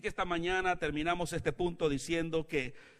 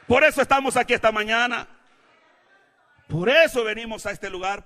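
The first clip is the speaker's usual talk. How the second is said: loud, raised voice, gradually easing; emphatic preaching; 5 semitones higher, 10 dB louder than usual. Real, not acted. Preacher telling church why they came, emphatic declaring. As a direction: declare it loudly, then ease off.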